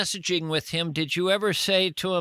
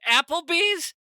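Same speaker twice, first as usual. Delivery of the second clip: high-pitched, incredulous echo of the name; 11 semitones higher, 4 dB louder than usual. Real, not acted. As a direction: repeat it as a disbelieving question. Reacts in disbelief to restaurant choice.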